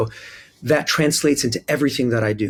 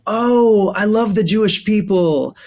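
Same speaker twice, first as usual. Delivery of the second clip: much higher, louder, gushing mock-sweet voice; gushing Southern churchgoer; 9 semitones higher, 4 dB louder than usual. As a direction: imitate a beaming Alabama churchgoer, all delight. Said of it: "people would go".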